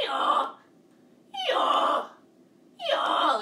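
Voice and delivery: loud, braying hee-haw, three times; donkey bray. Shown as a demonstration. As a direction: bray like a silly donkey for the kids. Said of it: "A donkey makes a really funny sound".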